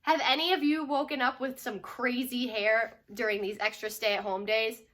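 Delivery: usual speaking voice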